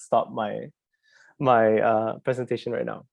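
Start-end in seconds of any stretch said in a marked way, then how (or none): none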